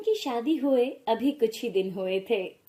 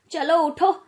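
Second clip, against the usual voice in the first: loud, higher barking order; impatient shout; 4 semitones higher, 6 dB louder than usual. Acted. Mother-in-law shouts at the fallen bride to get up, loud and impatient. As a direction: yell at her to get up now.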